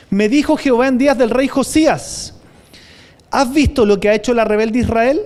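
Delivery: loud, forceful Bible reading; preacher reading scripture aloud. Acted. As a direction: proclaim the verse to the congregation. Reads Jeremiah aloud with force.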